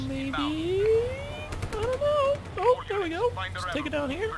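playful high cartoon-mouse impression; Mickey Mouse voice. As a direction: squeak it out like a cartoon mouse, slowly at first. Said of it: "sound like Mickey Mouse".

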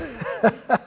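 short self-amused chuckle; a brief laugh to oneself. Real, not acted. Preacher admitting to congregation time is gone, short chuckle.

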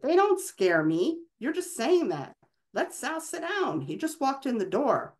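a mother finally boiling over, rising high; shouted. A narrator's reading. Mother shouting at her husband to let their son sit down, mildly voiced. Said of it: "could no longer contain herself and shouted".

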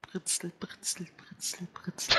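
whispered fizzing cola voice; fizzy soda whisper. Acted. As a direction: hiss it like bubbles.

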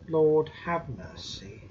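ordinary delivery

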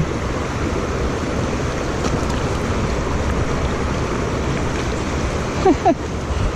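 a long, breathy, high giggle; delighted giggle. Real, not acted. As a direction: giggle at the little trout. Delighted by a tiny trout.

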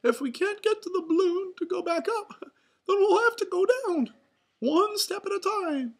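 very high, loud falsetto mouse; rally friends in a cartoon falsetto; Mickey Mouse. A narrator's reading. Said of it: "said Mickey".

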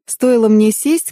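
ordinary delivery, nothing marked

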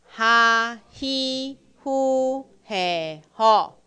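loud, drawn-out kana syllables; syllable drill. Shown as a demonstration. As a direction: call each syllable out clearly for the class. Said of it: "好，". (带读假名，每个音拉长念)

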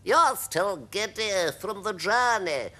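high, slow character voice; old grasshopper. Acted. Old grasshopper answering the ladybird, high slow voice. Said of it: "the old green grasshopper told her".

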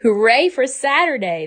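high, loud cheer. Acted. Ava and mother cheering for Saturday. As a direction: cheer it out with joy. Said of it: "they cheered".

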